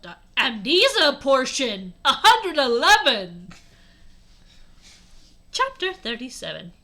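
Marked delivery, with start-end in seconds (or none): 0.0-3.5 loud, slow, hammed-up announcement; dramatic reveal. A narrator's reading. Reader reveals what was in the blood, mock-dramatic. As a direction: burst out with the big reveal.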